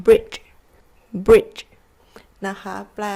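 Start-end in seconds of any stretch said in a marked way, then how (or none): 0.1-0.4 teacher's loud model of "bridge"; demonstrated GE sound. Shown as a demonstration. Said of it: "มันจะเป็นเสียงประมาณเนี้ย". 1.3-1.6 teacher's loud model of "bridge"; demonstrated GE sound. Shown as a demonstration. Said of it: "มันจะเป็นเสียงประมาณเนี้ย".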